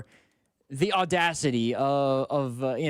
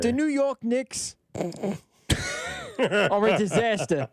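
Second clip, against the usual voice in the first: put-on slow, drawn-out New York accent, laughing through it; mock New York accent; 5 semitones higher, much slower than usual. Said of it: "golf update accent was way better".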